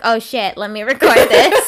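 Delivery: loud, laughing imitation of a friend; mock excited. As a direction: blurt it out laughing. Mimics friend grabbing phone.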